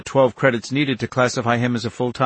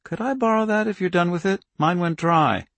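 put-on light, slightly husky young woman; sweet husky girl voice; 8 semitones higher than usual. A narrator's reading. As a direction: ask sweetly with a little husk. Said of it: "sweet and slightly husky".

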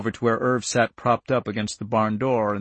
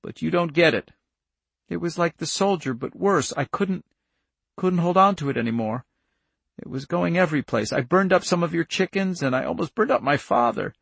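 put-on higher-pitched, upset little girl; distressed child; 6 semitones higher than usual. Charlie confesses to Irv about the fire, distressed. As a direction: confess it haltingly, in misery.

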